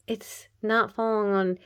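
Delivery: usual speaking voice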